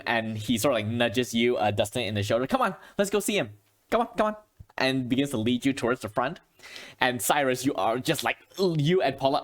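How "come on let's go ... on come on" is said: high, quick eager pushing; quick excited coaxing; eager urging. Acted. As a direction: hurry them along, excited. NPC urging players to come.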